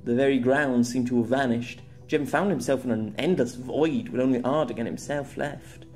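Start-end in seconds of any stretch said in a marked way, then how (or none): none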